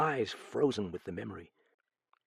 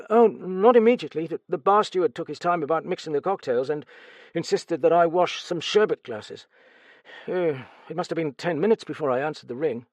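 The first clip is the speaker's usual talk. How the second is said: stammering, higher, louder frightened servant; nervous stammer; 7 semitones higher, 5 dB louder than usual. Acted. Steward questioned about the murder, nervous stammer. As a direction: stumble over the words in fright.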